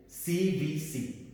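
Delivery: slow, deliberate C V C; letter by letter for the class; slow spelling. Shown as a demonstration. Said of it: "teacher spells letters slowly".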